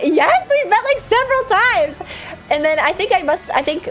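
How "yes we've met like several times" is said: exasperated insisting. Retold or imitated. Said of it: "And it was like".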